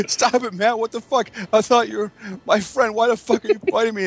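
high whiny pleading; mock whiny friend. Friend being attacked, high whiny pleading. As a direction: play the hurt friend, pitched high. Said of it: "Bayley's like".

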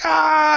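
loud, high-pitched yelp; sudden "ah" shrieked high and loud; freaked-out scream. Retold or imitated. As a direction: scream as if jolted out of a nightmare. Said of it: "I'd be freaking out".

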